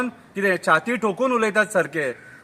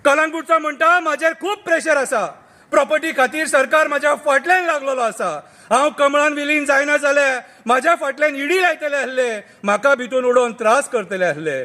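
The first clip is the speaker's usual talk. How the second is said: satirical poem recital; 6 semitones higher than usual. Acted. Reciting the turncoats' confession to friends, declaimed verse.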